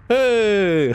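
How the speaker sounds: sudden high call; bright jump of recognition; excited. Real, not acted. Recognizing an old skin.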